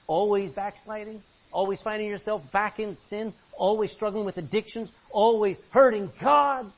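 high and loud, slowing into the last line; building preacher cry. Acted. Preacher challenging the congregation, rising in force. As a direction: hammer each question harder.

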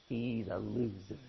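low, quiet sneering put-down; mocking. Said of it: "They mocked him".

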